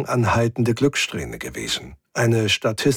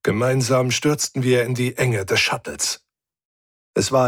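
ordinary delivery

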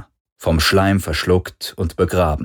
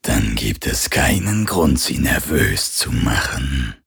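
lower, slow alien hunter voice; cold alien hunter; 6 semitones lower than usual. Acted. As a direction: say it coldly before killing.

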